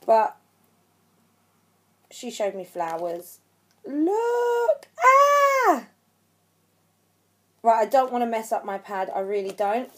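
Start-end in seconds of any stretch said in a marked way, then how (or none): 3.9-5.9 very high squeal into a loud shriek; excited squeal. Real, not acted. Vlogger showing the flowers she was sent, squealing in excitement. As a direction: squeal with delight, drawing it out. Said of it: "I was so excited".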